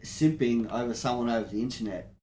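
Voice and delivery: ordinary talk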